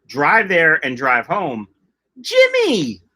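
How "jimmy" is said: high-pitched, drawn-out name call; excited greeting. Real, not acted. Streamer spots Jimmy joining the chat, excited greeting.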